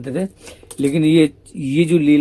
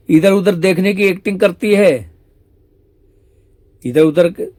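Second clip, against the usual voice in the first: higher, louder teasing reproach; mock rebuke; 5 semitones higher, 6 dB louder than usual. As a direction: call out her acting, raised voice. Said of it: "Shyam सुंदर बोले". (ऊँची, तेज़ आवाज़ में चिढ़ाती डाँट)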